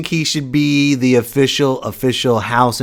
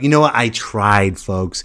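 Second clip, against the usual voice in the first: usual speaking voice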